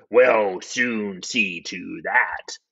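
low, slow war secretary. Acted. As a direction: draw it out low and deliberate. War secretary takes charge.

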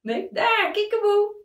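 put-on talking to a baby. Parent plays peekaboo with toddler.

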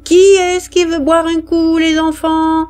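high, sustained vendor's call. Acted. Canteen woman offering soldiers a drink amid gunfire, calling out. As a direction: call it out on one high note. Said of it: "en criant".

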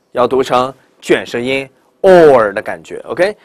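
ordinary talk, nothing marked

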